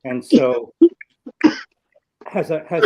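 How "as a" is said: ordinary delivery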